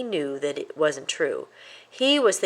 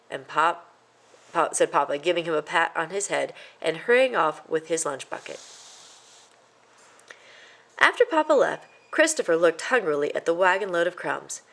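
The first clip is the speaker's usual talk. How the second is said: ordinary delivery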